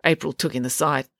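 ordinary delivery, nothing marked

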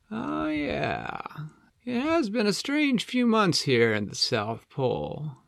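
high-pitched, easygoing penguin; Flippo. Acted. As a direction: penguin musing on strange times.

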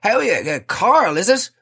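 high, bright fake-friendly greeting; macho bloke voice. To the repair man, putting on a matey voice. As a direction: act like one of the lads. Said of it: "I'm like".

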